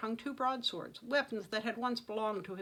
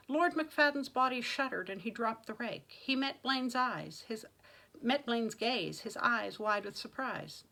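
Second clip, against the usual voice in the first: ordinary delivery, nothing marked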